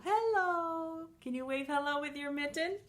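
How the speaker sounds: usual speaking voice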